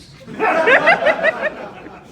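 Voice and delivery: loud high laugh; burst of laughter. Real, not acted. Narrator laughing at Ethan's quip.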